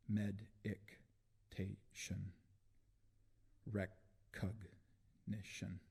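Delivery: slow, words broken into separate syllables. Acted. Poet repeats the hunger strikers' demands, slow and broken up. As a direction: draw out "Recognition" and "Medication" one syllable at a time.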